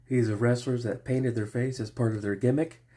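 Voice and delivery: ordinary delivery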